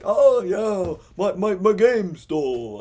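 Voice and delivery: high, loud, slow hammed-up British accent; cartoonish British accent, drawn out; mock British accent. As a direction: play a British gamer, overdoing the accent. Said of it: "If you're British and you're watching this, maybe you'll, like, be like".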